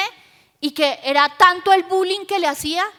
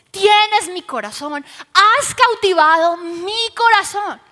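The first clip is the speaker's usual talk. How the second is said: raised, fervent preacher's voice; impassioned declaration; 4 semitones higher, 5 dB louder than usual. Acted. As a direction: proclaim love with full conviction. Husband's love declaration, preached with fervour.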